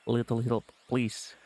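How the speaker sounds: soft, low-key Russian mommy voice; lightly Russian-accented, aiming deep and soft. Acted. Streamer trying a hunter voice, soft Russian accent. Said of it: "Hunters would have that deep, soft mommy sound".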